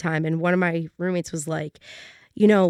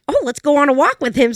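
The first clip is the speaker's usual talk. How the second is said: excited; 6 semitones higher, 5 dB louder than usual. Retold or imitated. Veronica lit up, eager.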